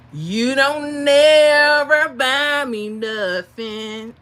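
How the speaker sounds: slow, loud sung-out call; yelled out the window. Retold or imitated. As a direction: holler it at him across the street, drawn out. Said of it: "I yell out the window".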